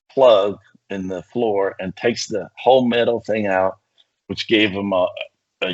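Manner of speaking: ordinary delivery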